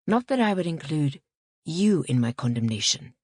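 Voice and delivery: usual speaking voice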